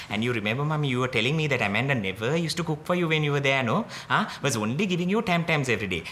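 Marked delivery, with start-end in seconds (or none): none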